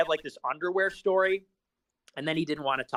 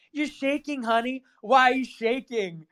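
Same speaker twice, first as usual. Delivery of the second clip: mock sassy taunt; 8 semitones higher than usual. Mocking taunt retold from clip.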